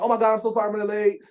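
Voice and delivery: excited. Retold or imitated.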